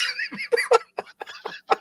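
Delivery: very high, unvoiced wheezing laugh; a breathless wheeze of a laugh. Real, not acted. Cracking up at the deer pee joke, a high breathless wheeze.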